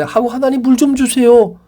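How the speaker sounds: high, loudening acted plea for help; complaining plea. Thirsty Israelites crying to God in the wilderness, high complaining plea.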